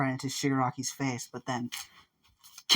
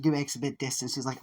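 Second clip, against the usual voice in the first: ordinary delivery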